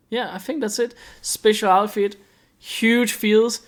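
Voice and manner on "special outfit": ordinary talk, nothing marked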